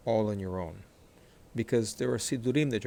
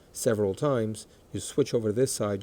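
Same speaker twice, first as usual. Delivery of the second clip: ordinary delivery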